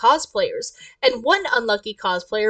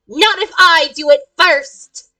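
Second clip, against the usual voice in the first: loud, slow, punched threat; fierce interjection; 5 semitones higher, 8 dB louder than usual. Acted. Character races to attack the cosplayer, fierce interjection. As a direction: jump in loudly, stretching the words.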